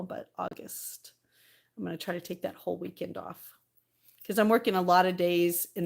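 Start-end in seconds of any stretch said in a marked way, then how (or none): 0.0-3.5 hushed aside. Real, not acted. Hushed aside about the weekend.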